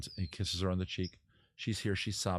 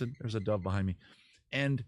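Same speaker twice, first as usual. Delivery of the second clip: ordinary delivery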